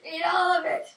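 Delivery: shouting a playful order; loud bossy command. Pretend. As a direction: yell it like a tyrant. Forcing veggies on friend.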